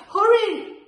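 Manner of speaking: high, loud urging cry; urgent call. A narrator's reading. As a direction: call out to the seed to hurry. Urging the seed to grow.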